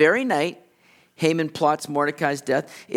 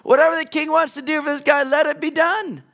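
mock grudging proclamation; 10 semitones higher, 3 dB louder than usual. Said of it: "now has to parade him around town and say".

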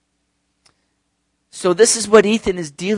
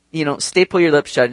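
usual speaking voice

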